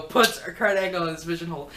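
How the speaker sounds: normal talking voice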